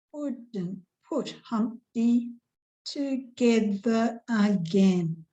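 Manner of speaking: slow, beat-marked reading of Humpty Dumpty; slow, rhythmic nursery-rhyme recital; metrical stress reading. Shown as a demonstration. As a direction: tap out each stressed syllable slowly. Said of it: "here's our stresses on the syllables".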